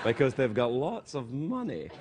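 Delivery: low-pitched posh Glasgow imitation; lightly put-on moneyed Glasgow accent. Host mocking the rich part of Glasgow, posh accent. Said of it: "They talk like this".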